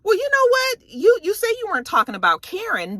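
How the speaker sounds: high-pitched acted challenge; mock confrontation. Recapping a friend's challenge at the table, mock confrontational.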